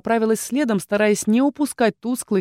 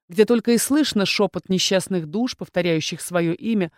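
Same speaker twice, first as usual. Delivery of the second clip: ordinary delivery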